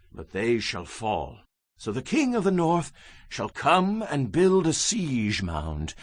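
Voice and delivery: normal talking voice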